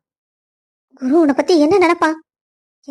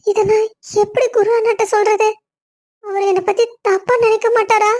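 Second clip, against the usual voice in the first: tearful; 5 semitones higher than usual. Acted. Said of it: "எதுக்கு இப்படி அழுதுட்டு இருக்க".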